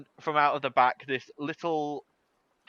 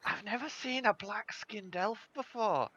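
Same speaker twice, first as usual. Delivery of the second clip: put-on high, hushed old-lady aside; Polly; 8 semitones higher, 5 dB quieter than usual. Grandmother leans over to Xandal about a stranger, high soft aside. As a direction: confide it softly in a higher voice. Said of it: "She leans over to you".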